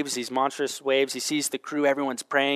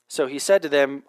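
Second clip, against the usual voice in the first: ordinary talk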